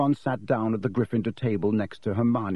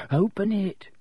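higher, pressing urge; urging; 10 semitones higher than usual. A narrator's reading. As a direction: push him to just do it. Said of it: "Neville urged".

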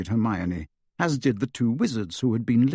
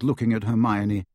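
usual speaking voice

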